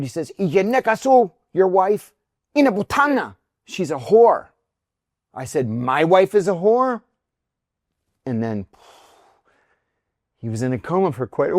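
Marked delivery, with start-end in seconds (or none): 0.4-4.5 high, loud agitated accusation; all excited. Retold or imitated. Other driver insults the wife to her husband, excited shouting. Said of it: "he gets all excited". 5.7-7.0 raised, loud disbelieving question; retold indignant echo, pitch climbing; incredulous. Husband answers the other driver's insult, loudly incredulous.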